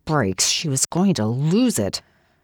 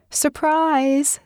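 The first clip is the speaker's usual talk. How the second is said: bright, much higher cheerful call; yelled surprise; 12 semitones higher than usual. A narrator's reading. Friends springing a party on Kathy, high cheerful shout. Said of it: "they'd yelled".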